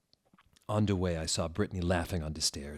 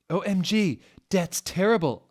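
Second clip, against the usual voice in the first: shocked, much higher and louder; gasped; 11 semitones higher, 7 dB louder than usual. A narrator's reading. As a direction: gasp it out in horror. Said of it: "I gasped".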